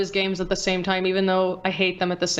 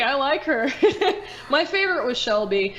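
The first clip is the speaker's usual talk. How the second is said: words broken by light laughter, a little higher; laughing while talking; 4 semitones higher than usual. Real, not acted. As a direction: chuckle through it.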